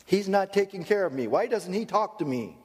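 high whiny complaining voice; complaining spouse. Retold or imitated. Spouse in counseling complaining about her husband. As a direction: whine like a neglected partner. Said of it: "when you work with a couple".

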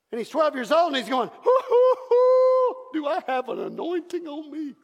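put-on high, loud whooping boy; giddy kid amazed at himself; hammed-up twelve-year-old; excited boy. Voicing boy Jesus.

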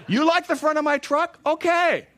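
high, loud, hammed-up road-rage taunt; mock angry driver. Pastor acting out road rage at a careless driver, high and loud. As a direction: play the furious trucker for laughs.

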